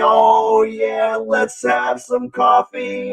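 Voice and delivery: loud, high, over-the-top character; hyped-up high voice. Acted. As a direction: shout it like a cartoon host.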